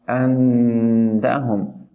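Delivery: long nasal hum held on the n of "andahum"; ghunna. Shown as a demonstration. Teacher showing stressed nun, "andahum" with held nasal n. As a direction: hum the n through the nose in "andahum". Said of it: "with ghunna on".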